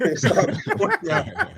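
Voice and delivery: laughing impression of wife. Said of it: "she would just be like".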